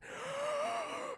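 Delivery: long, high, soft wheezing inhale; hammed-up deathbed gasp for air; drawn-out strained breath in; dying gasp. Dying grandpa struggles for breath.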